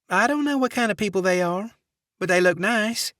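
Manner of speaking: sugary Southern receptionist; Southern accent. Acted. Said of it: "saccharine Southern accent".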